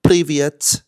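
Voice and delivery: Russian greeting. Retold or imitated. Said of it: "I speak a couple words of Russian".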